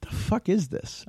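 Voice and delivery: a quiet, high what-am-I-watching gasp of dismay; disbelief at the movie. Retold or imitated. Fan recalling a bad superhero movie in theaters, stunned disbelief.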